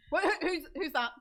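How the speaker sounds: high, loud, stammered question; startled alarm. Acted. Game master's character reacts to the changed form, startled and loud. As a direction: stammer it out loudly, voice jumping up.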